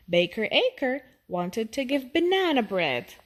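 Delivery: ordinary delivery, nothing marked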